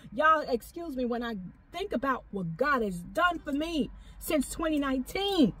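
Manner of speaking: ordinary delivery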